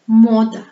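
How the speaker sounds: "mode" with a long, stressed O and light final a; exaggerated first-syllable stress in "mode". Shown as a demonstration. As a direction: lean hard on the O in "mode". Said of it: "Le son O est tonique".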